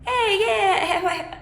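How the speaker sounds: put-on high, loud Australian accent; hammed-up, over-cheery Australian greeting; Australian accent attempt. Singer imitates happy Queensland locals, exaggerated Australian accent. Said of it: "can't even do an accent".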